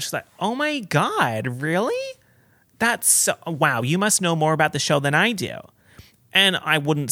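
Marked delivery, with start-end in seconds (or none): 0.4-5.6 high-pitched retold amazement; nice, impressed reaction. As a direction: imitate her being sweetly astonished, pitched up. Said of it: "she was so nice about it".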